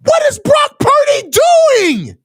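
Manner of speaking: high-pitched outraged yelling at the screen. Real, not acted. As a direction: yell at the TV in disbelief at the throw. Fan raging at interception on TV.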